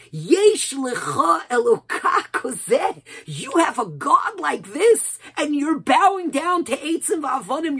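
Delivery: loud, high-pitched cry of the nations; impassioned shouted rebuke. Retold or imitated. Nations of the world turn to Klal Yisrael, impassioned shouting. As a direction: cry it out at full force, drawing the words out.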